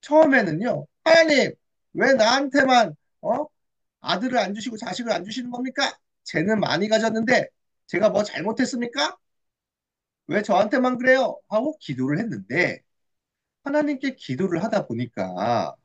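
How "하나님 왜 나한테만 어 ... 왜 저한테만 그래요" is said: protesting prayer. Acted. Hannah asks God why she has no son, protesting. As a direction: demand an answer from God.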